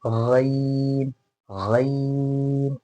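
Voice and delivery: very slow drawn-out गैन from the throat; Arabic ghain. Shown as a demonstration. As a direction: stretch गैन as a long gargled gh sound. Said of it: "र से क्या होगा".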